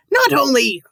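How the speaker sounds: bad Irish accent. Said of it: "I'm trying to sound like Mister Krabs".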